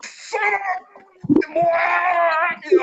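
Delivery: very high cut-off curse shout; angry yell. Retold or imitated. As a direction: scream it in frustration. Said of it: "he goes".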